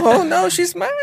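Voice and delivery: very high hammed-up panic; comic pretend terror; mock scared. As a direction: squeal in fake fright, laughing. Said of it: "had to start acting scared".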